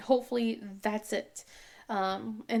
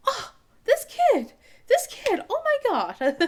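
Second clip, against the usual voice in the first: exasperated; 12 semitones higher, 6 dB louder, slower than usual. Real, not acted. Mom exasperated about daughter.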